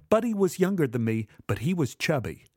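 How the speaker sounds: ordinary delivery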